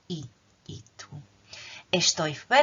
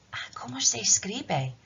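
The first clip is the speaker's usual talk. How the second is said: whisper; 4 semitones higher than usual. Acted. Unvoiced line in a dialogue.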